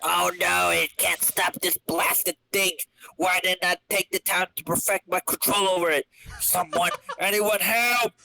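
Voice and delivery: panicking villain. Acted. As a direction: plead for rescue, high.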